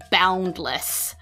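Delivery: usual speaking voice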